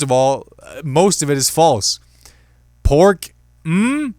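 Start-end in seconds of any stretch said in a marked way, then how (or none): 2.8-4.1 high, loud disbelieving "Pork?"; mock outrage at the label; incredulous. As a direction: scoff at the word Pork. Mocking the spam label.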